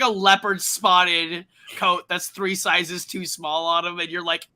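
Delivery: ordinary talk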